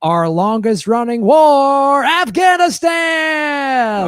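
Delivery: big announcer reveal, slow, building to a high stretched shout; game show voice. Acted. Host revealing the answer, game show voice. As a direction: draw out the last word like a host's grand reveal. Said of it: "when you do the game show voice".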